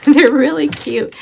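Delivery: amused, delighted little laugh while talking. Real, not acted. Laughs while admiring fabrics.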